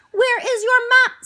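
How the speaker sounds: high, loud, squeaky robot. Acted. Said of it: "in his squeaky robot voice".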